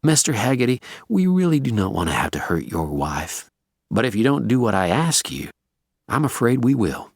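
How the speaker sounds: calm, courteous threat; polite gunman's measured warning; unfazed, consummately polite. A narrator's reading. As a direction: threaten with perfect, unruffled manners. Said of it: "Unfazed and still consummately polite".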